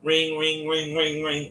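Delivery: high sing-song ring ring; phone ring imitation. Kid acting out the phone ringing, high ring ring.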